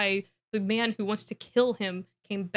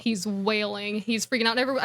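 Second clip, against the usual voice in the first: normal talking voice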